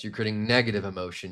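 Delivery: ordinary talk, nothing marked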